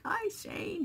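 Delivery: imitating grandma brightly; high grandma voice. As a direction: pitch it up as a cheery grandmother. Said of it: "She's like".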